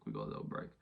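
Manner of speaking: deep voice. Pretend. Repeating the news to viewers in a deep low voice. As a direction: drop the voice way down for the repeat.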